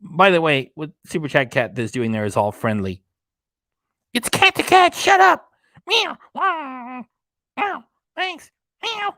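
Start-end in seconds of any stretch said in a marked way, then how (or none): 4.1-9.1 squeaky high cartoon cat. Cat voice argues back.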